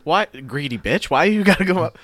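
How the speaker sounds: high, exaggerated indignant scolding; mock outrage. Scolding a movie character for demanding a massage, mock outraged.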